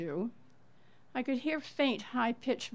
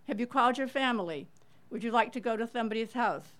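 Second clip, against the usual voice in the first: quick offers; 3 semitones higher, faster than usual. Retold or imitated. Rapid questions to grieving family.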